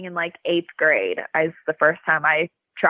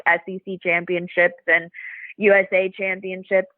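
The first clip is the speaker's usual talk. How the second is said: ordinary talk, nothing marked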